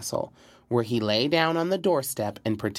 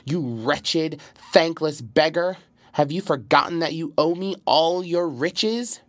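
angry outburst; 6 semitones higher than usual. A narrator's reading. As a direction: rebuke the ungrateful count sharply. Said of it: "sprang up and cried".

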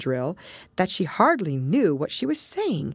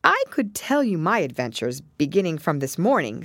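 plain reading voice

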